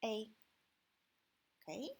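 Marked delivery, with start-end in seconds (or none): 0.0-0.3 "A" said as the pinyin final ei, like English ay. Shown as a demonstration. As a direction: glide "A" as ei. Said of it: "should be A".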